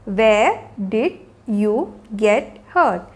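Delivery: very slow, every word set apart. Shown as a demonstration. Teacher modelling a question about an injury for learners, word by word. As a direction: pause after each word of the question.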